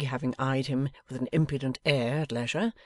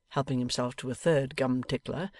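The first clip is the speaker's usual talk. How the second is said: usual speaking voice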